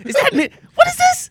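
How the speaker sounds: high incredulous mock question; laughing disbelief. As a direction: pretend to be baffled, laughing.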